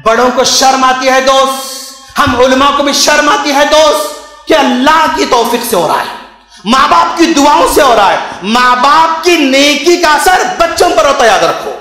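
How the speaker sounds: impassioned preaching. Acted. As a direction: preach it forcefully to the congregation. Preaches at full force. (जोशीला उपदेश)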